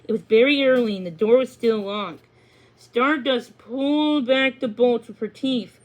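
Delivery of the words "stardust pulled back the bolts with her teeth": ordinary delivery, nothing marked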